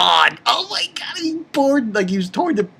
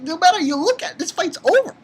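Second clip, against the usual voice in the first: higher, lightly voiced knight declaring victory; a hint of a confident knight; mock knight voice; 14 semitones higher, faster than usual. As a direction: announce the fight's end in a higher pitch. Quoting the Knight's verdict.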